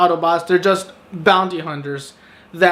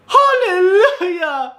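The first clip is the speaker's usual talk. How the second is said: much higher, slow, cried-out hallelujah; joyful hallelujah; 12 semitones higher, much slower than usual. Real, not acted. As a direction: celebrate like a prayer was answered. Relief the annoying friend dies.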